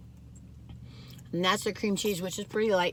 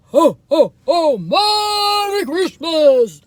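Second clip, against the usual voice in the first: booming Santa laugh, high and loud; belly-laugh Santa greeting, slow and big; jolly ho ho ho; 7 semitones higher, 10 dB louder, much slower than usual. Retold or imitated. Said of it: "I used to be Santa".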